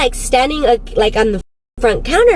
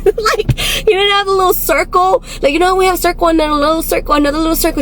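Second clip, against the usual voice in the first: higher, giggly delivery; laughing; 6 semitones higher than usual. Real, not acted. Podcaster giggling over the missing circle in the eyes, laughing. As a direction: talk through your laughter, pitched up.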